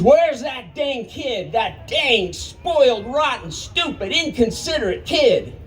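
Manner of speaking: put-on slow, high furious yell at the kid; angry scream. Grandpa yelling at the missing kid, slow angry shout. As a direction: spit out each insult, slow and high. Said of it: "And he screams".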